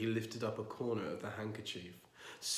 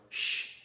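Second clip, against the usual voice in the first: shush. Acted. Shushing spouse.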